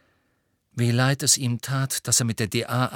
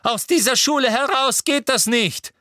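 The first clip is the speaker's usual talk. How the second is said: loud, high-pitched cry of protest; shriek; 13 semitones higher, 6 dB louder than usual. A narrator's reading. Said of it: "schrie Umbridge".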